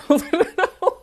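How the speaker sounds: sudden high burst of laughter; cracking up mid-story. Real, not acted. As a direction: laugh loud and high at yourself. Laughing at own quote.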